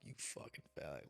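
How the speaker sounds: bitter insult whispered under the breath. Retold or imitated. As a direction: hiss it away almost silently. Muttered insult at grandmother.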